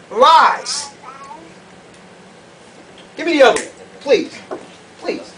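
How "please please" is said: ordinary talk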